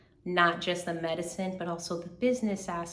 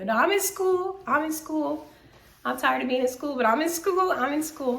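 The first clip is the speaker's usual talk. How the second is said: high, loud, emphatic protest; playful insistence; 8 semitones higher, 6 dB louder than usual. Real, not acted. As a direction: insist loudly in a raised pitch, setting doubters straight. Insisting against viewers' supposed doubts.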